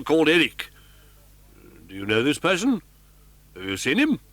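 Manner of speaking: police officer doubting the story; sceptical commissary. Acted. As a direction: probe him like a suspect. Commissary challenges Raoul.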